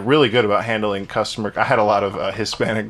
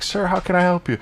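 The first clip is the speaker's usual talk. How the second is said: higher-pitched, mock-polite service quote; polite customer-service voice; 7 semitones higher than usual. Manager retelling his reply to a ranting customer, politely higher-pitched. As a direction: lightly put on a helpful clerk's voice.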